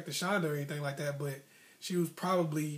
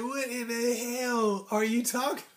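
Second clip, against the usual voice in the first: baffled; 6 semitones higher, slower than usual. Retold or imitated. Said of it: "I was like".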